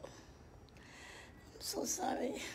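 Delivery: very slow, hushed apology; whispered sorry. Acted. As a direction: breathe the sorry out slowly. Whispered sympathy to caller.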